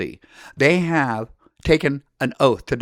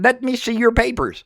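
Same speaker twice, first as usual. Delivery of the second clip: mock enforcer demand; 7 semitones higher than usual. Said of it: "knocking on your door ... going".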